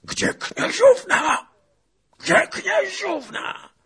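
high, drawn-out shouted question; wild, loud demand; frantic scream. A narrator's reading. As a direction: scream it while shaking him for an answer. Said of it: "wrzasnął".

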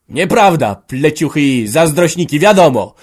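loud, high outburst of denial; exclaimed aloud. A narrator's reading. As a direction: fling the words out at full voice. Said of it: "wykrzyknął w głos". (głośny, wzburzony okrzyk)

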